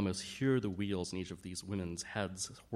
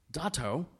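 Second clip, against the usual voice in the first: higher and louder, an alarmed complaint; upset cry; 9 semitones higher, 6 dB louder than usual. A narrator's reading. Eleanor complains loudly to the Dato, alarmed and upset. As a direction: tattle to the Dato in distress. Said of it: "Eleanor cried".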